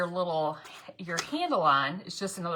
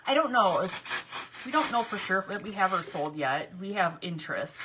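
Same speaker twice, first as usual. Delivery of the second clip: usual speaking voice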